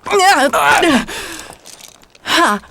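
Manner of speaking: strained high grunt of effort being hoisted up; effort climbing on. Acted. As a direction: heave yourself into the saddle. Hauled up onto the horse.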